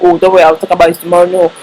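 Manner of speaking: ordinary talk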